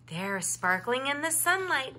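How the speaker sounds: ordinary delivery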